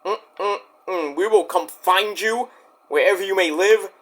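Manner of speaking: usual speaking voice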